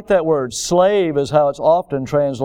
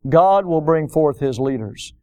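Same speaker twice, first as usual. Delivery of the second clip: ordinary talk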